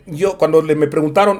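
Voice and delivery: ordinary delivery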